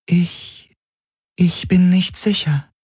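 high, otherworldly voice, faltering; hesitant alien entity. A narrator's reading. The Helix unsure if it understood the crew. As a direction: let the strange voice hesitate.

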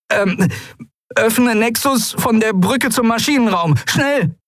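high, hurried command; urgent young voice. Acted. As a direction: push the ship to obey fast. Miko commands ship urgently.

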